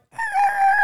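high, loud car-scrape screech; piercing mimicked scrape; imitated scraping screech. It was like.

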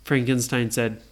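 usual speaking voice

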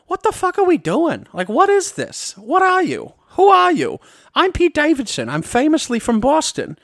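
high-pitched, exasperated Boston-flavored impression; Pete Davidson. Pete Davidson walking around backstage, baffled high-pitched impression. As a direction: play a bewildered celebrity host in a high voice. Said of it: "It's not that good of an impression".

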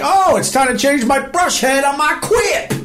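high, gleeful rise in pitch; excited customer. Acted. Host acts out a customer getting a new brush head, excited. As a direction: exclaim it in high, delighted excitement.

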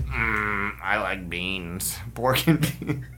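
low, slow grunting drawl; lightly voiced slow Southern man; Sling Blade impression. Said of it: "I was thinking Sling Blade".

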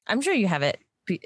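normal talking voice

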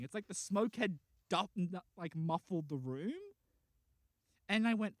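ordinary talk, nothing marked

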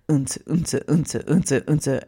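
mouthed club beat, unta unta on a steady pulse; a light beatbox of the music next door; imitating thumping rave bass. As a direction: mimic the dance music pounding through the ceiling. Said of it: "all we could hear above us was".

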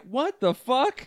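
high, slow, drawn-out disbelief; baffled outcry. Retold or imitated. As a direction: stretch it out, stunned at them. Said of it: "everyone else is like".

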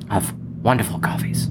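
quick ASMR whisper. Acted. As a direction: hush it out fast. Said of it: "A S M R".

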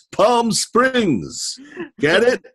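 high, loud showman punchline; hammy comedian voice. Acted. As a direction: sell the pun like a showman. Rip Taylor joke voice.

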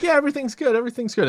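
high, breezy fake reassurance. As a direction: brush it off, all is fine. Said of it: "false status reports".

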